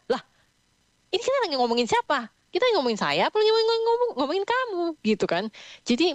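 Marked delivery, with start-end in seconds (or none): 0.1-4.8 put-on confused child asking who this is about; puzzled child. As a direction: voice climbs high on the last question. Anak bingung, bertanya. (anak bingung, suara naik tinggi)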